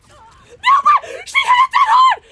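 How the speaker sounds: high shrieking disbelief; excited screaming. Real, not acted. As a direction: scream it out in delight. Teammate's big play, screaming.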